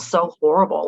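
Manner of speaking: ordinary delivery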